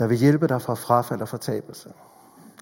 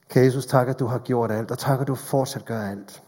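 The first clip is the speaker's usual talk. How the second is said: spoken prayer; 2 semitones lower than usual. Acted. Leads closing prayer. (stille, langsom bøn)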